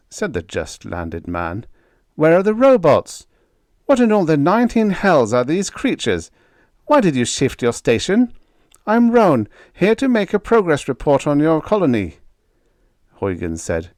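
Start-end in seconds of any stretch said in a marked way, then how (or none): 2.1-12.2 high, loud and demanding, a pompous officer; brusque officer Roan. Acted. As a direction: bark questions like an affronted senior officer.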